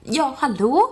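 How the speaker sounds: lightly acted caller, slow and high; retold phone greeting. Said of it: "Hon bara".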